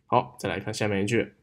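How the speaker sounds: ordinary delivery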